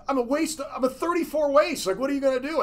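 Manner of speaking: high mock protest to the coach asking for his pants; mock whiny protest. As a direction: squeak out the protest like you are being robbed. Quoting himself, pleading about pants.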